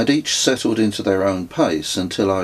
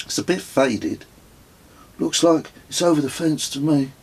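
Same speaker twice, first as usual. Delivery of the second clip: put-on lightly higher boy, considering; a hint of a thoughtful child; son's voice; 7 semitones higher than usual. A narrator's reading. Son judging the faded arrow, lightly voiced boy.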